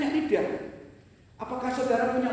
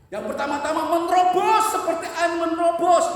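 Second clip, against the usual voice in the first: preaching shout; 5 semitones higher, 5 dB louder than usual. Acted. Preacher builds to a climax.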